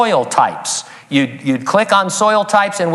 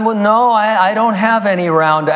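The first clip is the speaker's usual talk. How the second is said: playing innocent; 8 semitones higher than usual. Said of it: "But I acted".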